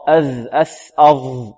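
"أذ، أث، أظ" with each final consonant held, tongue tip at the upper teeth; interdental articulation. Shown as a demonstration. Teacher demonstrating letters made with the tongue tip, interdental articulation. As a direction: draw out the closing sounds of "أذ، أث، أظ" distinctly. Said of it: "لابد من إخراجه من منتهى طرف اللسان".

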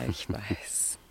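breathy, secretive whisper; a hushed little admission. Acted. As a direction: let it fall to a whisper. Softly agreeing after a laugh.

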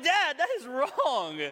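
high, slow wounded protest; mock indignation. Son protests dad's one-liner, mock indignant. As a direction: drag out a high comic objection.

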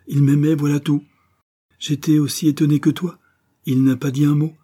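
pouring out her secret; breathless confession. A narrator's reading.

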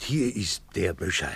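normal talking voice

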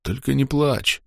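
faint, slow gurgling plea; soft, dying, blood in mouth; bloody mouth. Acted. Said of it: "попытался улыбнуться Илья".

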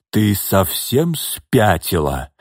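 slow, pitiful exclamation; plaintive cry. Acted. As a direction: plead it out slowly, bewildered. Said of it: "жалобно воскликнул он".

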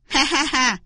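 short self-satisfied cackle; gloating "ha ha ha"; smug laugh. Acted. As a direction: laugh like a conceited trickster. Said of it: "Nó thường dương dương tự đắc".

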